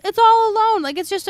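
loud, high, wailing sympathy; dramatic pity. Real, not acted. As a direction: wail it out for the turtle. Pitying the lonely turtle.

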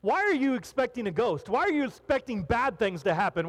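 ordinary talk, nothing marked

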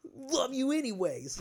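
high, slow sarcastic brush-off; dismissive teenage fine, love you anyways; snippy fine. Retold or imitated. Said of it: "I'm like".

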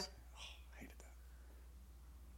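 slow breathy whisper of dislike. Real, not acted. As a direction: whisper it, slowly, with distaste. Whispered groan about camp memory.

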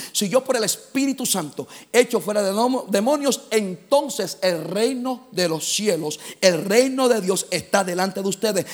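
loud, punched-out scripture proclamation; emphatic preaching. Acted. Preacher proclaims the verse to the congregation, emphatic preaching. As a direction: preach it out with force.

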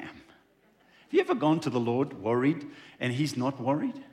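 normal talking voice

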